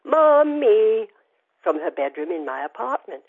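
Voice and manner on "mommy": put-on high, loud little girl's call; child's voice calling out. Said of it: "listen for a child's voice calling out".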